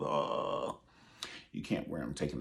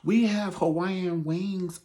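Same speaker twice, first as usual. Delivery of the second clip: high, slow sing-song offer; mock server voice; 12 semitones higher, 6 dB louder, much slower than usual. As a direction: drawl the offer like a cheery host. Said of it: "Somebody like".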